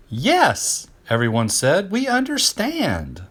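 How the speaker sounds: high, loud keen yes, we understand slowed; eager chorus yes. Acted. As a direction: sing out agreement like eager pupils. Friends agree eagerly.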